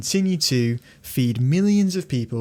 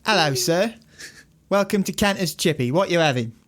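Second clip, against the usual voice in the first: lightly higher, cheery shop worker greeting; chippy server; 6 semitones higher than usual. Pretend. Greeting a customer at the chippy, lightly higher chirpy voice. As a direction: play the chip shop server welcoming a customer. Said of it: "order it exactly as you would".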